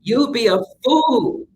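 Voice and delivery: ordinary delivery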